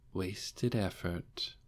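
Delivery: soft, slow murmur; gentle murmur. Acted. Demiurge murmuring gently at the lizard men, soft and slow. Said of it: "murmured at them gently".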